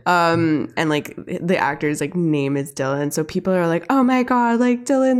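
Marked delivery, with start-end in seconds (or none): 3.9-5.2 higher-pitched mock excitement; gushing fan squeal, lightly acted; freaking-out fans. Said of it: "they're all freaking out".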